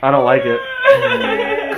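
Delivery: slow, very high laughing squeal; creeped-out squeal. Pretend. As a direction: squeak it out slowly, giggling. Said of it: "Creeps me the hell out".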